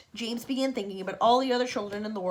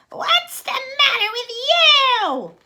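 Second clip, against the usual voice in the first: much higher and louder, slow screeching demand; screeched; 11 dB louder, much slower than usual. Acted. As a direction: screech at the boy. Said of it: "Aunt Spiker screeched".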